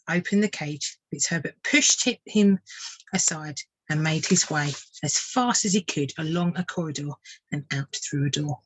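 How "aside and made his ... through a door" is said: ordinary delivery, nothing marked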